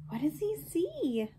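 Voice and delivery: usual speaking voice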